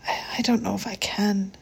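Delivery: broken whisper through tears; sobbing. Acted. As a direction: choke out the words, crying. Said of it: "She was sobbing now".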